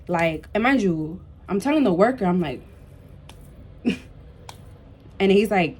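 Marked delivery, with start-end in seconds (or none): none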